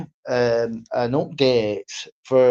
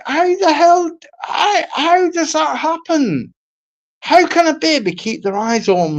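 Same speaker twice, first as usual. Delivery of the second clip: exasperated outburst; 11 semitones higher than usual. Real, not acted.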